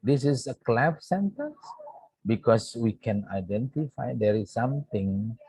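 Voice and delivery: usual speaking voice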